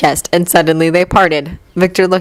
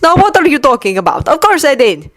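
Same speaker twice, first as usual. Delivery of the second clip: exasperated reply; 7 semitones higher than usual. Acted. Victor answers in quarrel.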